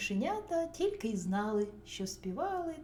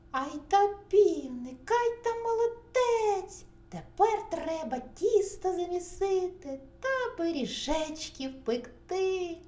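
high, loud mouse voices; gushing praise; 7 semitones higher, 7 dB louder than usual. A narrator's reading. Mice praise rooster and hand him more work, gushing. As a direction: pipe out admiring praise. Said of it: "не нахваляться".

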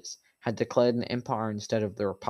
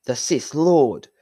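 shocked outcry; 5 semitones higher, 10 dB louder, much slower than usual. Acted. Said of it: "Obi-Wan thought".

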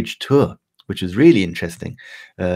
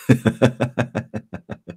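amused laugh; 4 semitones higher than usual. Real, not acted. Laughs at his own joke.

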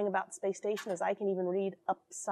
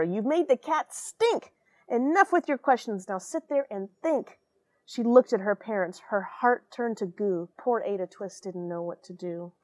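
lively read-aloud storytelling; expressive storybook voice; story narration; 6 dB louder than usual. A narrator's reading. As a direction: read it like a bedtime picture book. Rhyming picture book read aloud.

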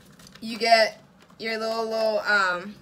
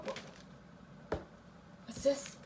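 normal talking voice

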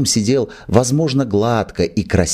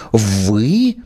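indignant. Acted. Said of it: "возмутилась она".

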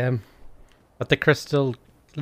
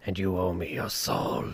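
low, slow reaper voice; Grim Reaper voice; 7 semitones lower, 6 dB quieter, slower than usual. Pretend. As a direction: slowly claim their soul, low and dark. Continues the villain bit.

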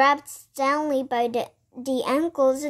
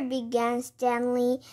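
ordinary delivery, nothing marked

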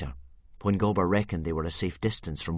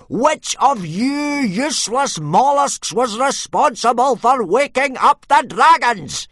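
furious shout, much higher, louder and slow; exploding rant; 14 semitones higher, 12 dB louder, slower than usual. A narrator's reading. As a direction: blow up at the hopeless recruits. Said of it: "he exploded all over".

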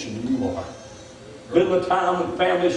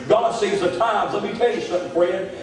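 normal talking voice

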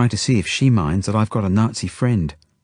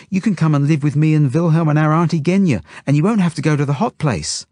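put-on high, loud little-girl voice; Zelda; 8 semitones higher, 5 dB louder than usual. A narrator's reading. Zelda bargains with the couple, high loud child voice. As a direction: pipe up eagerly like a small child.

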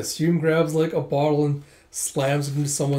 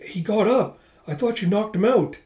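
high-pitched acted dismay; alarmed; 7 semitones higher than usual. Girl to rescuer as the drunk staggers up, alarmed. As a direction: blurt it in startled disbelief.

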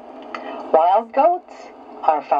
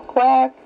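duck quack; 6 semitones higher than usual. Shown as a demonstration. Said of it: "Can you quack and waddle like a duck?".

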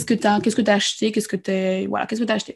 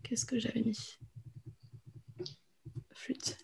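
whispering to herself; 19 dB quieter, slower than usual. Real, not acted. Teacher searching her notes.

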